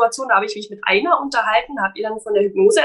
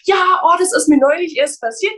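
begeistert; 7 semitones higher, 4 dB louder than usual. Retold or imitated. Zitiert begeisterte Bekannte.

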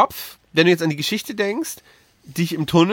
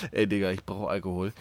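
schläfrig gemurmelt; 10 semitones lower, 10 dB quieter than usual. Retold or imitated. Freund im Halbschlaf zitiert.

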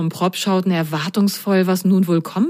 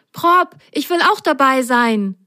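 high, cheering outburst; gleeful me-too excitement; jubilant; 7 semitones higher, 3 dB louder than usual. A narrator's reading. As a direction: burst out eagerly to your friend. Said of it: "jubelte Milo".

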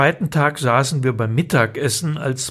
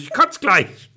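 put-on whiny child; 6 semitones higher than usual. Child threatens in car.

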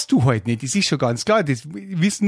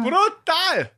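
much higher, hammed-up outcry; mock exclamation; 13 semitones higher than usual. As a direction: blurt it out in exaggerated awe. Exclaims mockingly.

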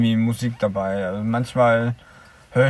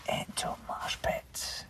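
whispering; 18 dB quieter, slower than usual. Pretend. Joking about storm as moshpit.

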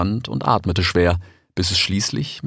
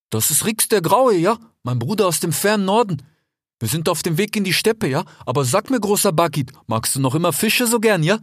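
put-on a slightly higher, friendly young fox; lightly voiced fox; 11 semitones higher than usual. A narrator's reading. Desert fox talking to his brother Rix, lightly voiced. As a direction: play the eager desert fox. Fennec flatters vulture.

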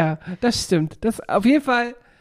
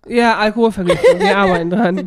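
laughing; 7 dB louder than usual. Real, not acted. Joking about alcohol poisoning.

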